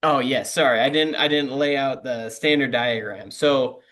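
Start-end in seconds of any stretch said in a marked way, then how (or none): none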